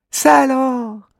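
put-on high, wondering child voice; amazed little girl. Alori discovering the tiny pianist, amazed girl voice. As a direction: gasp in wonder at the sight. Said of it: "murmure Alori".

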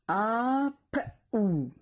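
"Apou" stretched out sound by sound. Shown as a demonstration. Teacher dictating a syllable to pupils, sounded out slowly. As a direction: draw "Apou" apart into a, p, ou. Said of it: "c'est la syllabe".

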